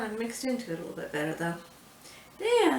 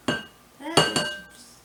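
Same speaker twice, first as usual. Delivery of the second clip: amazed; 6 dB louder than usual. Real, not acted. Amazed at the creamy icing.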